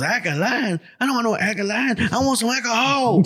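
high-pitched mock cranky old man; grumpy old man. Retelling old man refusing alkaline water, grumpy. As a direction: play his indignant demand for laughs. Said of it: "this old man is now grumpy".

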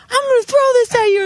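high, fast retold threat; shrieky reenacted rage; so mad. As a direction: act out yelling a threat across the street. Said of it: "I was so mad".

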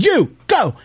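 high, sharp imitated command; mock scolding. Old lady ordering first dog into car, mock yelling. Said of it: "she is still yelling at 'em".

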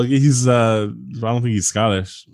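ordinary talk

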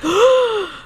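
big high gasp of shock. Shown as a demonstration. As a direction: gasp as if stunned. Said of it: "I want you to be like".

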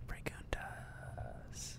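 breathy Spanish whisper, very slow. Acted. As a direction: whisper the word slowly. Whispered Spanish word aside.

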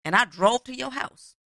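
high, indignant tirade ending in a question; acted pissed-off outrage. Woman who drove over confronting the man, pissed-off outrage. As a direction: play the woman who feels cheated. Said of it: "and she, pissed".

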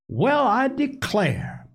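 high, drawn-out delighted exclamation; sing-out motherly surprise; cheery. A narrator's reading. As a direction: call out cheerily on walking in. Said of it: "Missus Pepper's cheery voice".

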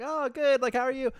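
sugary put-on politeness; mock sweet reply. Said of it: "I was like".

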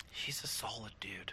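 whispered aside; hushed, voiceless whisper. Real, not acted. Hushed aside about friend.

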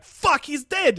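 sudden high alarmed cry; alarmed exclamation. Retold or imitated. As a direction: blurt it out in shock. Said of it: "I'm like".

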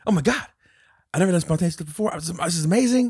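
high, loud imitation of a thrilled man; mock exhilaration. Man who finally jumped, imitated excitement. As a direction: gush it, hammed-up and high. Acts out man's thrill after jumping.

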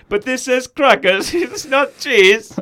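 put-on high, loud, slow foreign accent; Borat. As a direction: protest slowly in a comic foreign voice. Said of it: "And then Borat goes".

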